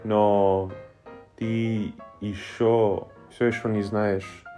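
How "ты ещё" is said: ordinary talk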